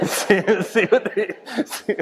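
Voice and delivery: laughing while talking, high; chuckling through the words. Real, not acted. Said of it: "laughing at own quip".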